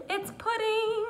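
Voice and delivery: excited. Real, not acted. Showing pudding, excited.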